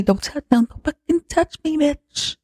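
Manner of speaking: a hammed-up frightened squeal; panicked shriek. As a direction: recoil in mock terror, voice jumping up. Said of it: "losing it".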